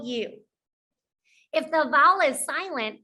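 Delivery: ordinary talk, nothing marked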